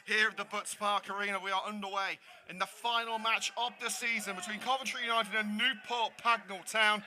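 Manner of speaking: upbeat, fast broadcast intro; match announcer. Acted. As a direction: welcome viewers like a TV sports host.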